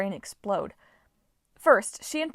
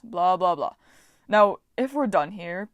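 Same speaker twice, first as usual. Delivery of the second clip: dismissive blah blah; 5 dB louder than usual. Acted. Bored god brushing them off.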